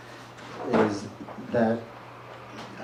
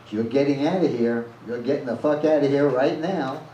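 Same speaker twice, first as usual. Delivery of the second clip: louder, forceful order to get out; shouting; 3 semitones higher, 5 dB louder than usual. Retold or imitated. Bartender shoving Ruthie out the door, shouting at her.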